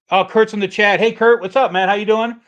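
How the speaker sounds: loud, high-pitched hello to a newcomer; excited greeting. Real, not acted. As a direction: call out happily to a friend just arriving. Greets viewer arriving in chat.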